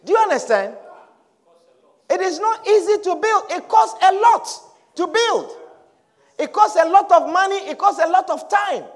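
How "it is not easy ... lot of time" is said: raised, forceful sermon delivery; emphatic preaching. Acted. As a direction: preach it to the congregation, pitch lifted.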